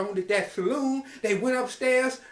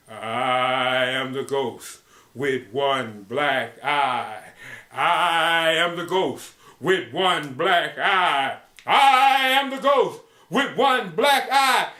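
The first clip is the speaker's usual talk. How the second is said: ghost voice; 3 semitones lower, much slower than usual. Acted. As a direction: draw it out like a ghost, swelling each time. Ghost chants its warning.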